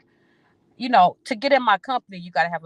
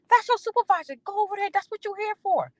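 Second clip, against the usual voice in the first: put-on pitched way up, scolding the young coworker; high-pitched scolding; 14 semitones higher than usual. Scolding a young coworker at work, high pitched. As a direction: lecture him sharply from high up.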